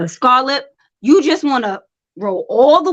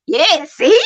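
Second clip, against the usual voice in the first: usual speaking voice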